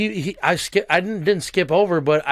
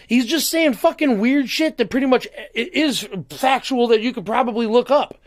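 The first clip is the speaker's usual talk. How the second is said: ordinary talk, nothing marked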